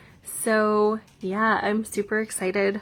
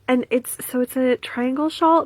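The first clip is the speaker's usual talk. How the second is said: ordinary delivery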